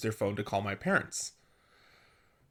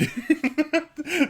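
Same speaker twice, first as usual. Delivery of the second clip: high giggling laugh at herself; bright helpless giggle; burst of laughter; 3 dB louder than usual. Real, not acted. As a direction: let a squealing laugh out. Laughing at her own story.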